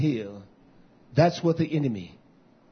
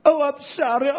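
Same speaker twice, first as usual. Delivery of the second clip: mock crying; 10 semitones higher, 4 dB louder than usual. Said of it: "They will cry".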